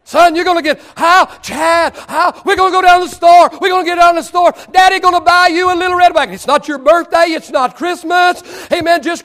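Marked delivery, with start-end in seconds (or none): 0.1-6.3 loud, high, eager father promising his son; a thrilled dad shouting the promise; excited daddy. Acted. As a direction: pump up the little boy with big news. Preacher acts excited father.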